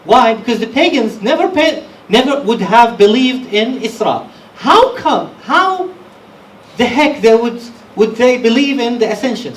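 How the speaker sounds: loud, high rhetorical questions; fiery preaching. Acted. Preacher challenging listeners about the pagans, fiery and loud. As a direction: hammer the point home to the congregation.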